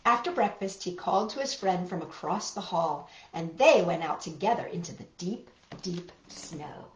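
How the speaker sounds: put-on gentle read-aloud for small children; picture-book narration for little listeners; storybook reading. A narrator's reading.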